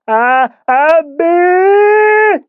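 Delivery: high, loud strained cry; wordless cry. A narrator's reading. Tied boy jerking on his cot, high loud cry. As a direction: yell out against the ropes.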